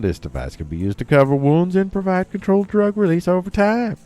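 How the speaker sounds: put-on southern accent with swooping pitch; southern accent news reading. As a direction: read it in a playful southern accent. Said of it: "doing a southern accent for this story".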